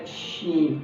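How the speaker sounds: شین with open spread lips; shushed شین; hushing sheen. Shown as a demonstration. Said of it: "شین ایسا لفظ ہے".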